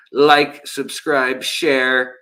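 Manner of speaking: flat mechanical robot voice. Pretend. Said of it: "robot voice".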